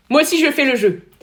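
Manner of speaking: loud, high-pitched shout; loud call. Pretend. Someone joining in the game, loud call.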